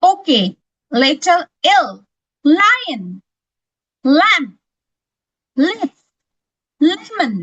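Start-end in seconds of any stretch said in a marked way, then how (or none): none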